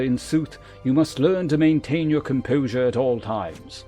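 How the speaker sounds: formal, measured mentor with deliberate emphasis; lancer guildmaster. Acted. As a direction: instruct a pupil with grave authority. Voices the lancer guildmaster's dialogue again.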